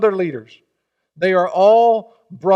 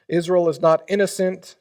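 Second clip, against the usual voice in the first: ordinary talk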